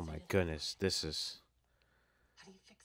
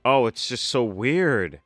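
loud, raised pitch, real amazement; amazed excitement; 4 semitones higher, 6 dB louder than usual. Real, not acted. Fan seeing Vanessa back after a season, amazed. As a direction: exclaim it in happy disbelief.

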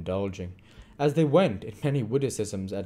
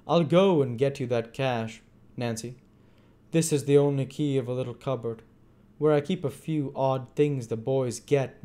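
higher-pitched, ingratiating character voice; wheedling old schemer; 6 semitones higher, 5 dB louder than usual. A narrator's reading. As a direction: plead poverty to someone you want to placate.

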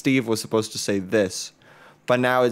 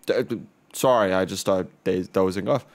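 ordinary delivery

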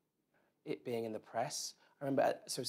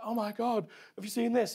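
excited gasp; 11 semitones higher, 5 dB louder than usual. Retold or imitated. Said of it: "You're like".

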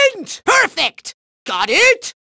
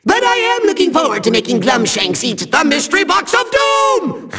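usual speaking voice

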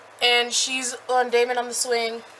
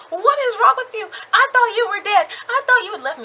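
high, loud, laughing outburst; frantic yell; 10 semitones higher, 7 dB louder, faster than usual. Retold or imitated. Katie yells at Damon after he resurfaces, high and loud. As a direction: scream at him out of relief and fury.

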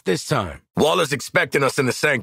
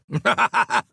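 high, hearty burst of laughter; gloating laugh; 5 semitones higher than usual. Acted. Mocking a beaten opponent, gloating laugh. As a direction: laugh triumphantly at the enemy.

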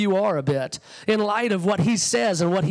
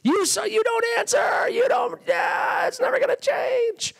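high, accusing yell at God; accusing shout; 13 semitones higher than usual. Retold or imitated. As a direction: hurl the complaint upward in frustration. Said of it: "The accusation changes".

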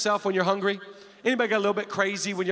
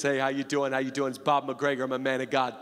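Bob's low voice; 9 semitones lower than usual. Retold or imitated. Pastor imitating his father-in-law Bob greeting people, low. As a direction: drop the voice way down to do Bob. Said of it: "He talks like this".

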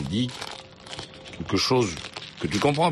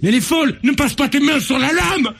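high, loud alarmed yelling; alarmed shouting; 6 dB louder than usual. Real, not acted. Stops her touching the blade.

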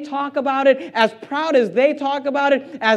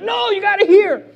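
high-pitched indignant plea; mock outraged insistence at a closing door; mad soul winner; 10 semitones higher, 4 dB louder than usual. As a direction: mimic an offended, pushy evangelist. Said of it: "they get mad".

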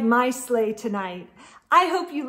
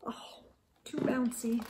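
hushed comment; soft aside; 10 dB quieter than usual. Real, not acted. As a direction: drop your voice to point something out. Quiet aside.

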